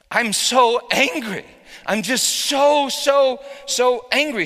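high, loud and slow, spitting out the anger; angry outburst. Retold or imitated. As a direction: rage it out as she did. Wife's anger retold at top volume.

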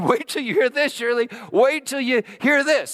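high, loud call up the walk; excited yell. Retold or imitated. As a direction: shout the news ahead of arriving. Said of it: "just yelling".

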